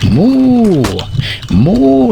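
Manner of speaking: cow mooing. Pretend. Man showing guys his cow, long mooing. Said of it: "He's saying moo".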